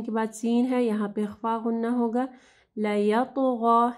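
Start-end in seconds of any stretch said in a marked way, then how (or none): 2.8-3.9 लयतग़ा slowly, joined as one word, no break after ला; correct tajweed. Shown as a demonstration. Teacher demonstrating the right reading, slow and joined. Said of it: "ला यतग़ा नहीं पढ़ना".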